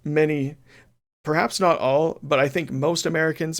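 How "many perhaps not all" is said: ordinary talk, nothing marked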